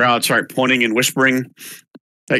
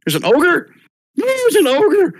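rising, shrill panicked crowd voices; alarmed crowd; 13 semitones higher, 3 dB louder than usual. Acted. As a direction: gasp it around the crowd in alarm. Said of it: "you hear lots of whispers and shouts".

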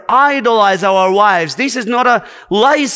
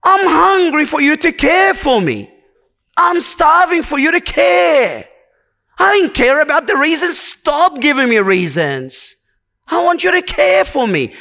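wife pleading to be cared for; 6 semitones higher than usual. Acted. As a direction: plead as a longing wife, voice lifted. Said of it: "what she really means is that".

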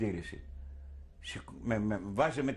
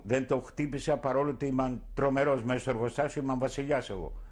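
normal talking voice

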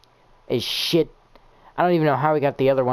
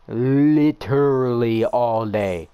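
drawn-out whine; 3 dB louder, much slower than usual. Real, not acted. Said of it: "the pain".

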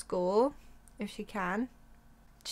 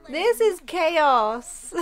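loud, slow, drawn-out groan of despair; exasperated outburst; 6 semitones higher, 8 dB louder, much slower than usual. Real, not acted. Player watching her Sims table go wrong, loud slow exasperation. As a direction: throw up your hands at the mess. Stranger sits at their table.

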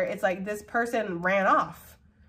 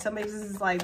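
ordinary talk

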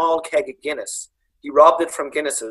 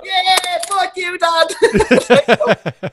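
put-on much higher, very drawn-out kid voice; mock bratty kid; 3 dB louder, much slower than usual. Mocking kids cheeking their dad on telly, drawn out. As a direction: stretch it out like a cheeky child.

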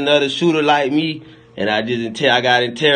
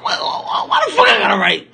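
mock tantrum, pitched way up; whiny mock outburst. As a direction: squeal it like a put-upon kid. Acted whiny complaint.

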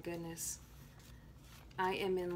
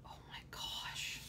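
awed whisper; 9 dB quieter than usual. Real, not acted. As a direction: whisper it, stunned by the gift. Awed at opened mail.

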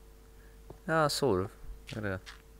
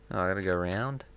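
ordinary talk, nothing marked

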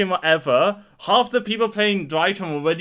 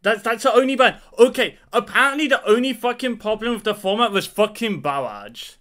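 loud, higher-pitched angry rant; pissed off; 4 semitones higher, 4 dB louder than usual. Real, not acted. Player complaining about the only ban, pissed off. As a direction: rail against it, loud and raised in pitch. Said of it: "I'm fucking pissed".

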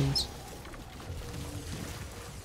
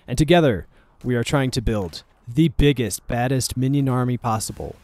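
usual speaking voice

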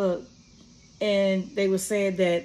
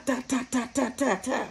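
quick spoken ta-ta-ta like a gun going off, higher and softer; mock gunfire; 4 semitones higher, 5 dB quieter than usual. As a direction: rattle off the gunshots with your mouth. Imitating the drive-by gunshots.

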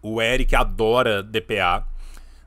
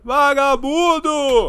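high, loud, slow drawn-out yell; mock shout; 13 semitones higher, 8 dB louder, much slower than usual. Streamer echoing the clip, mock outraged yell. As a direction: bellow it like an outraged cop.

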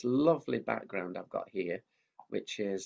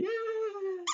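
much higher squeaky puppet. Acted. Mickey the puppet cutting in, high-pitched. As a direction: make the puppet pipe up.

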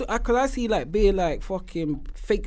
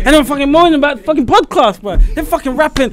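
raised, louder mock grumble; imitated cussing rant; 5 semitones higher, 6 dB louder than usual. Podcaster imitating brethren moaning about rappers, heated mock rant. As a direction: rant like an exasperated friend. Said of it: "He cusses. He's like".

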